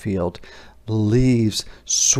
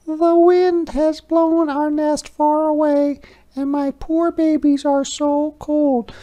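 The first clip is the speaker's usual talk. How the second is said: much higher, tiny squeaking mother mouse; squeaky mouse voice; 4 dB louder than usual. Acted. Mother Mouse frets to Hedgehog in a high squeak. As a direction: squeak it like a worried little mouse.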